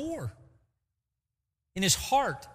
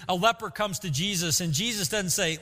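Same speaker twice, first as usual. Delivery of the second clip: normal talking voice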